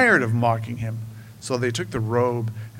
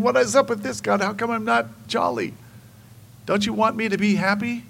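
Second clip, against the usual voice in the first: higher, mildly whiny mock complaint to God; 7 semitones higher than usual. Believer mock-whining to God about not being happy. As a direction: play the pouting believer, lightly. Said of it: "shrugging our shoulders and saying".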